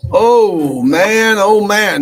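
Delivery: big loud exasperated oh man; loud excited groan. Real, not acted. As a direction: belt it out, fired up and fed up. Loud excited opening exclamation.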